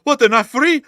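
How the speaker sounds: high, loud shocked question; hammed-up shocked soldier; mock outrage. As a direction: squeal it in disbelief. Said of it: "the Russians like".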